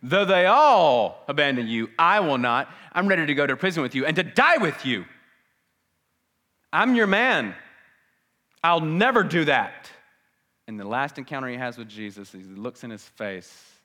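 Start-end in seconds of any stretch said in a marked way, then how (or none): 0.0-9.9 loud, boastful, confident promise; Peter's bold vow. Pretend. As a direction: swear it loudly like a proud disciple. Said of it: "because he promised Jesus".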